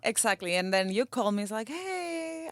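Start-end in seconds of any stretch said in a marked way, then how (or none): 1.7-2.5 soft, higher, timid little hey; mock meek hey from the caught husband; sheepish husband. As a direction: play the guilty husband testing the waters. Wife mimics husband's sheepish call.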